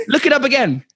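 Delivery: high-pitched, hammed-up order; imperious mock customer voice; demanding. Customer ordering the clerk to search, high and demanding.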